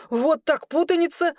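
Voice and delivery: high-pitched amazed exclamation; surprised. A narrator's reading. Kirush realises the mix-up, surprised. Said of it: "Кируш удивлённо хлопнул себя по лбу".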